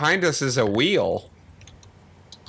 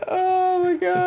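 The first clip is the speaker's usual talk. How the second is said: laughing; slower than usual. Real, not acted. Reacting to being tossed.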